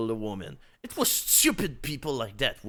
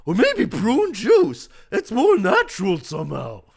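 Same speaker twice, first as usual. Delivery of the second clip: fussy voice; 7 semitones higher, 11 dB louder than usual. Acted. Bruce continues.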